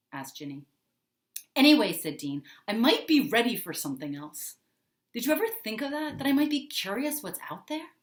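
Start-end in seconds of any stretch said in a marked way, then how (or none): 1.5-1.9 louder, clipped restart; firm loud anyway. A narrator's reading. Dean forcefully restarts his answer to Ginny, loud. Dean snaps back firmly. 2.7-7.9 heated pushback. A narrator's reading. Dean pushes back on Ginny.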